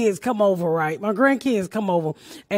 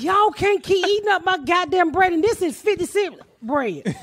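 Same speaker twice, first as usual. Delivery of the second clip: put-on higher, exasperated scolding of the kids; mock scolding mom; 6 semitones higher than usual. Mom scolding kids for eating all bread, exasperated. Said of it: "I'm like".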